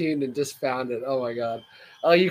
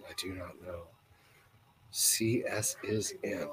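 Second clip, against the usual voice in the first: whisper; 7 semitones lower, 9 dB quieter than usual. Real, not acted. Reading a puzzling message.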